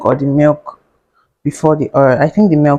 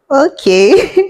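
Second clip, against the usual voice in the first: high-pitched okay between laughs; retold bemused reaction, laughing; amused, awkward okay; 10 semitones higher, 3 dB louder than usual. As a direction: draw it out as a rising, giggly question. Amused disbelief at his oversharing.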